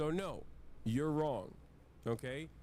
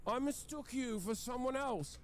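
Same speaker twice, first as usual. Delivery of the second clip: high haughty nobleman, then higher apologetic guard; posh noble voice; 10 semitones higher than usual. Retold or imitated. Telling his chat a game example in a high haughty noble voice. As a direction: act out the snooty noble and the meek guard. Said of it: "And the guards will be like".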